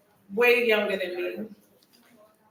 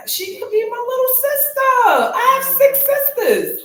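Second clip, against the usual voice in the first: fighting back tears, laughing through them; tearful laugh; 11 semitones higher, 6 dB louder than usual. Real, not acted. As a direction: voice rising, half laughing, half crying. Moved talking about her friend.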